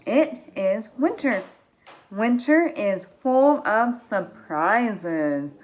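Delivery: put-on slow, drawn-out read-along voice for children. Shown as a demonstration. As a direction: stretch each word for the kids to follow. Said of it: "read with me".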